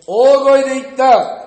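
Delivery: high, very loud proclamation; big, booming announcement to a crowd. Acted. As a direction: call it out at full volume.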